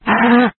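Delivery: short scream of pain; burning scream. Acted. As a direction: cry out as the heat hits. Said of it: "tiếng kêu gào thê thảm".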